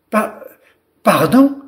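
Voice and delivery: broken, high, questioning stammer; hesitant storybook stutter, rising at the end. A narrator's reading. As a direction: stumble on the first syllable, then ask it. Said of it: "bafouilla Lila".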